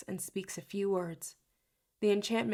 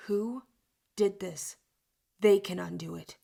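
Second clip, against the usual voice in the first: growl in the voice; 5 dB louder than usual. Acted. Jude demanding to know who cursed Heather, growling and rising. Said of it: "My voice has a growl to it".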